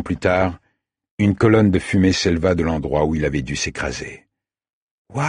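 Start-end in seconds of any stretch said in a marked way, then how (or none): 5.1-5.3 an awed wow that slips out; amazed exclamation. A narrator's reading. Girl watches a plane crash in the distance, amazed exclamation. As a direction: let the wow escape in wonder. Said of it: "laissa échapper Amélia".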